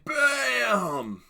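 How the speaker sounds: high, loud, long-held impact yell; explosive shout. Retold or imitated. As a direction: blast it out and stretch it. Reenacting the big moment.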